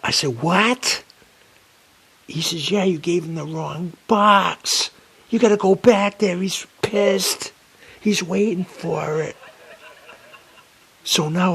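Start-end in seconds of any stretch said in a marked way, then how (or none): 0.4-1.0 high, loud rising what; hearing he delivered the wrong box; shocked what. Retold or imitated. 2.7-9.4 high, loud, slowed reproach; loud scolding. Retold or imitated. Dispatcher scolds about wrong box.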